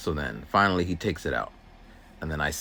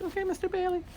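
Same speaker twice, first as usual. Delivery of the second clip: put-on much higher, softer little girl's voice; young student voice; 5 dB quieter, faster than usual. Student repeating the aide's name, in a high soft girl's voice. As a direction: repeat it like an obedient kid.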